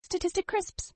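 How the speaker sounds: high, rising jingle-style product name; high product reveal. Acted. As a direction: sing out the brand name up high.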